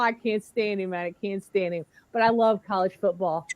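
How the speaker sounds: ordinary talk, nothing marked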